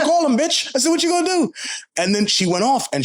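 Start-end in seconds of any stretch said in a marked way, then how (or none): 0.0-1.5 much higher, mocking taunt; taunting dare. To the old lady threatening ICE, a high mocking taunt. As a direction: taunt her, daring her to act.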